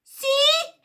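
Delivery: very high loud yell; second henchman yelling, heavy accent. A narrator's reading. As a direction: yell it in fury. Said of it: "yells the second man".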